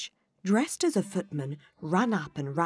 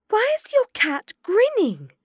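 put-on much higher girl's voice; young Alice voice; 9 dB louder than usual. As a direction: ask it like a curious girl. Alice speaks.

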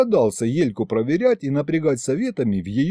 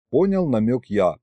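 plain reading voice

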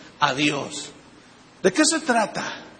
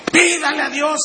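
preaching shout; 6 semitones higher, slower than usual. Real, not acted. Preacher shouting at full force.

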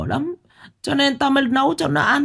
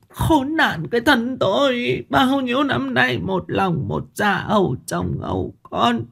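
wailing lament; 13 semitones higher than usual. A narrator's reading. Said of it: "khóc bù lu bù loa".

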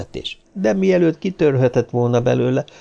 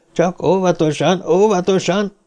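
high-pitched call for caution; insistent high warning; urgent warning; 8 semitones higher than usual. A narrator's reading. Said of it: "Steve zombori figyelmeztetése".

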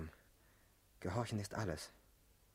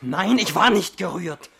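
slow, loud, higher protest; indignant denial; 6 semitones higher, 15 dB louder than usual. Acted. Husband denying he was moved, loud indignant protest. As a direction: deny it hotly.

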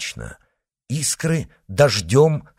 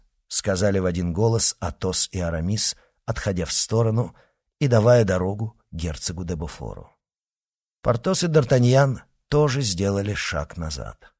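ordinary delivery

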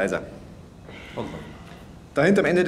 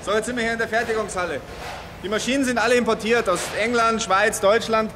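raised voice; 7 semitones higher than usual. Acted. Speaking over factory noise.

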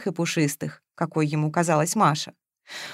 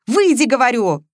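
loud, higher command; heated, no-argument demand; insistent order; 5 semitones higher, 6 dB louder than usual. A narrator's reading. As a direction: order them out impatiently. Man orders the friend out.

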